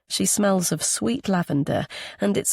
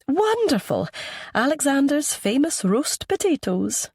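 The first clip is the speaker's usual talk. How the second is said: high, delighted Anglo-Scottish woman; Anglo-Scottish accent; 9 semitones higher than usual. A narrator's reading. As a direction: exclaim in an Anglo-Scottish lilt. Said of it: "in that same Anglo-Scottish accent".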